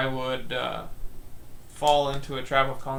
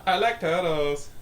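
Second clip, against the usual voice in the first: goofy meme voice; 4 semitones higher, 3 dB louder than usual. Acted. Meme quote.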